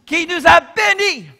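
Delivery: slow, high, shouted proclamation climaxing on bénis; fervent preacher's shout; preaching at full force. Acted. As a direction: thunder out the blessing to the church.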